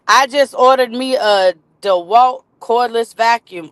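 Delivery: ordinary talk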